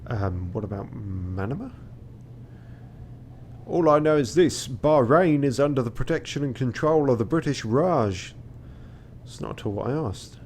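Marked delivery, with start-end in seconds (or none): 3.7-8.3 Ottoman captain. Acted. Voicing captain's scripted reply.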